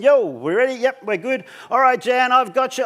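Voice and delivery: calling up high to the brake person; yelling out. Retold or imitated. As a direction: call out across the rooftop. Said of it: "I'm just gonna yell out to the brake person".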